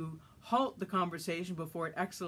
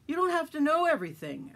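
higher, louder angry rebuke; with ire; 9 semitones higher, 6 dB louder than usual. A narrator's reading. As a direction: scold him, fed up. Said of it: "Adam expounded with ire".

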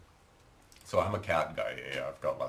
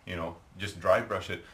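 ordinary talk, nothing marked

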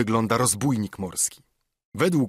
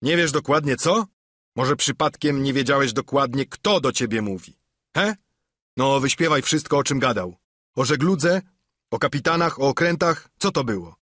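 louder, higher sarcastic interrogation; mock fury of a ship's cook; badgering; 4 semitones higher, 3 dB louder than usual. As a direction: needle Morgan until he talks. Silver grills Morgan.

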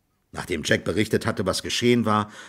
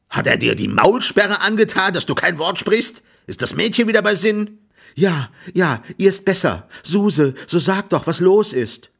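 high, agitated pressing questions; worked-up farmer, raised pitch; impatient questioning; 9 semitones higher, 4 dB louder than usual. A narrator's reading. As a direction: press them impatiently for an answer. Agitated farmer presses servants for answers.

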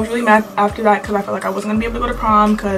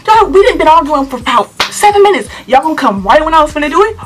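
high, loud exasperated protest; hammed-up outrage, pitched up; reenacted indignation; 6 semitones higher, 4 dB louder than usual. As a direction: protest the interruption in disbelief. Reenacts outrage at being interrupted.

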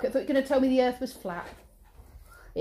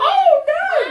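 high, loud oh no with a laugh; mock alarm; 12 semitones higher, 7 dB louder than usual. Mother reading to child, hammed-up alarmed oh no. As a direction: play up the shock for the child.

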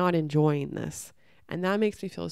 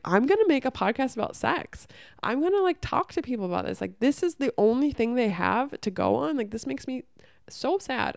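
high-pitched, indignant retold vow; worked-up resolve, voice jumping up; angry outburst; 5 semitones higher than usual. Vowing to make a podcast about sex, angry. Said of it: "I just got really angry".

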